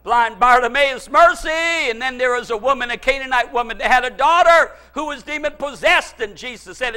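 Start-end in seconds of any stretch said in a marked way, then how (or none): 1.1-1.5 high, loud beggar's plea; crying out. Retold or imitated. Preacher as blind Bartimaeus calling to Jesus, crying out. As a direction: holler for mercy in a short burst. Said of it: "two blind men crying out".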